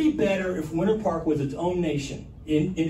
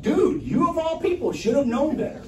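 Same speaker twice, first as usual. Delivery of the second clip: mock frustration, pitched up; exasperated retort; 8 semitones higher than usual. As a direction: scold him like a know-it-all friend. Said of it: "I'm like".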